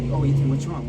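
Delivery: loud, worried outburst, mildly acted; alarmed concern. Mom and therapist reacting to a yell, alarmed. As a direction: call out in mock alarm.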